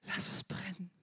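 hushed surrendering whisper. Retold or imitated. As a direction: breathe the prayer. Whispered prayer.

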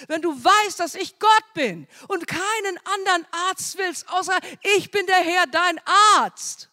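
high, loud fervent preaching, building to a cry; preaching at full force. Acted. Preacher crying out to the congregation that the Lord heals, fervent and loud. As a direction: proclaim it to the whole congregation at full voice. Preacher proclaims God's covenant.

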